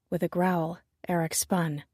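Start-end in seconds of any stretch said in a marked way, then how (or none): none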